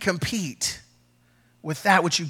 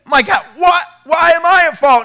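high, loud cry of protest; indignant outburst; 11 semitones higher, 8 dB louder than usual. Retold or imitated. Pastor reliving his protest to his mentor, loud and indignant. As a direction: shout it like you've been wronged. Retelling outrage at the rebuke.

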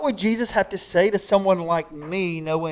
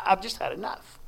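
weary give-up; 12 dB quieter than usual. Pretend. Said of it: "he just goes".

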